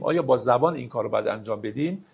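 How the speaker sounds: ordinary talk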